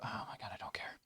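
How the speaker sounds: whispered under breath. Real, not acted. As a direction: mutter it in a whisper. Aside muttered while playing.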